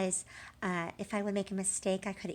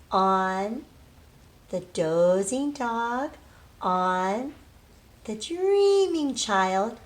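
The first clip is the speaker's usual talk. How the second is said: slow, rhythmic chant of the sleeping pile; storybook recital; 2 semitones higher, 7 dB louder, much slower than usual. Acted. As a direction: build the stack word by word for children. Recites character chain slowly.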